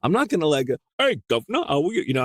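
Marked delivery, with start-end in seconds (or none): 0.0-0.7 high, eager mock tourist voice, pitch way up; gushing put-on voice ending in a cheesy greeting; imitating a naive accent learner. Narrator mocking an eager beginner's fake accent. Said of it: "I'm like". 1.0-2.0 high, hammed-up cockney greeting; cliche British accent. Narrator mocks a cliched British accent. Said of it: "know I'm doing an accent".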